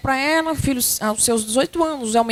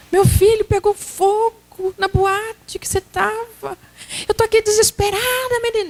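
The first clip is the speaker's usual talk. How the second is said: desperate mother; 8 semitones higher than usual. Acted. Mother greets late son, higher and frantic. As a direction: blurt out a frantic mother's relief and panic.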